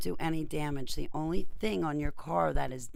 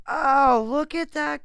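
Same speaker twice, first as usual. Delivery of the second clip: excited; 12 semitones higher, 11 dB louder, slower than usual. Real, not acted. Excited at the result.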